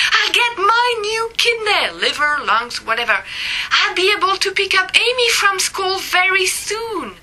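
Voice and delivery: high-pitched, excited announcing; triumphant phone call. A narrator's reading. Woman phoning round about her new organ, triumphant. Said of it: "triumphing phone calls".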